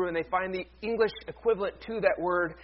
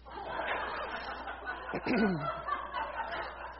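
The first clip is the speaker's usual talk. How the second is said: soft breathy laugh; a hushed little laugh; quiet laugh; 7 dB quieter than usual. Real, not acted. Laughter after the joke.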